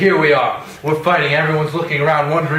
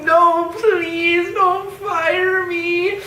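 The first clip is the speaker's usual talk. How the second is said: very high falsetto whine; mock pleading; much slower than usual. Othello imitating Cassio begging for his job, mock falsetto pleading. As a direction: beg in a squeaky drawn-out wail, imitating.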